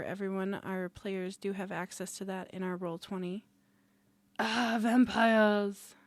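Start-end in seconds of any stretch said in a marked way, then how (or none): 4.4-6.0 slow theatrical gasp then whisper; hammy gothic exclamation. Acted. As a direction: ham up the vampire reveal.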